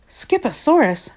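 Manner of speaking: high-pitched echo of SKIPOSAURUS; startled declaration. A narrator's reading. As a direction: repeat it in disbelief, pitch leaping up. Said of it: "declared Mama Junebug Jones".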